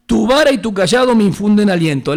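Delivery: loud, high ringing psalm reading; fervent preacher reading the psalm aloud; proclaimed scripture. Acted. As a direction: proclaim the verse to the whole church. Psalm quote proclaimed from pulpit.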